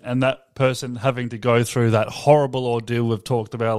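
normal talking voice

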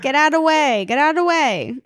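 high, mock Jersey street yell; Jersey accent. Podcaster riffing on the accent, high Jersey impression. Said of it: "The Jersey accent".

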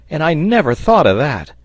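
high, loud exclamation; sailor's shout. A narrator's reading. Sailor answering the engineer's suggestion, shouting. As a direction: call it out, struck by a new idea. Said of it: "shouted the sailor".

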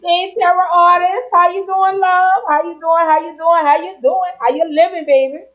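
high, lively sing-song welcome; excited high greeting. Real, not acted. Host greets her favorite guest, high and excited.

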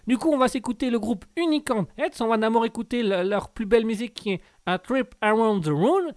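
présentation musicale forte et plus aiguë; annonce radio. Acted. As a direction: annonce le programme à pleine voix. Annonce les morceaux à l'antenne. (ton d'animatrice radio, haut et sonore)

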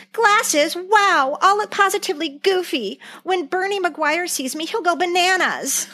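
high, bright, hammed-up teen girl; hammed-up young Jan, dreading looking goofy; mock girly Jan. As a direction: play the dismayed teen, over the top. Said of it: "my favorite line from the episode".

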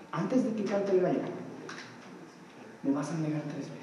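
almost breathe it out in sorrow; hushed sorrowful voice, fading away; sad. Retold or imitated. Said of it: "yo me imagino que con tristeza".

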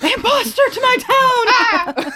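mock outrage. Mock accusation in character.

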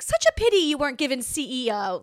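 patronizing. Retold or imitated. Needling her arrogant ex with sweet patronizing pity. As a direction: pour on fake sympathy. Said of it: "I was so patronizing to him".

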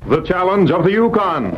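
booming radio announcer, title ringing out. Acted. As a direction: announce the show title big and loud.

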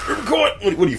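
ordinary talk, nothing marked

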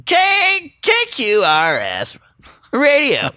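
hammed-up radio host, swooping high; radio announcer. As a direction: belt the station call letters, stretched out. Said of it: "it says, uh".

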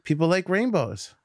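put-on high, bright-eyed child announcing a discovery; little girl voice. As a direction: play the four-year-old, pitched high. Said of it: "She goes".

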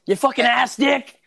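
high, loud, cursing at the screen; yelling at the TV. Acted. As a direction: bark a furious curse at the game. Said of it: "put two swear words together".

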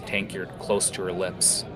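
normal talking voice

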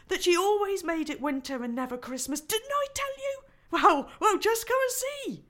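high, loud gleeful voice; crowing that he was right; triumphant beaver. Acted. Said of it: "answered Mister Beaver".

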